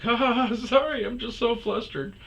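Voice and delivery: high-pitched fake giggle and apology; put-on girly flustered voice, very high; mock giggly woman. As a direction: mimic her flustered laugh in a squeaky high voice. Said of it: "she starts laughing, and I'm like".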